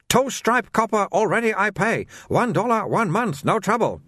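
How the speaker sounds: high-pitched, sing-song sales patter in broken English; haggling like an eager shopkeeper; pushy shopkeeper Soon. Acted. Soon voiced again.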